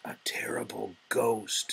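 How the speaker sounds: hushed, spooky whisper; whispered warning. Acted. Father whispering to the lost little frog, hushed. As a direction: whisper it like a secret fear.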